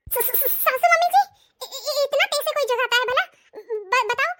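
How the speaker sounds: slow, high, stammering just-woken voice; startled sleepy daughter-in-law, stammering; groggy stammer. Acted. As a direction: stumble over the words, half asleep.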